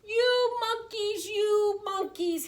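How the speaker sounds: high, drawn-out scolding; scolding peddler. A narrator's reading. Peddler to the monkeys in the tree, scolding. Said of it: "This made the peddler very angry".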